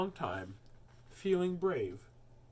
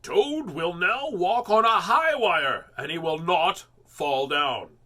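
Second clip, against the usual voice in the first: slow, loud showman announcement, higher; strange announcer voice; 5 semitones higher, 11 dB louder, slower than usual. A narrator's reading. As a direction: present the high-wire act grandly. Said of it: "said the voice".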